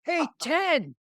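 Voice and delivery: shouted high and bright across the street; yelling a name again. Retold or imitated. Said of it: "calling him Ten".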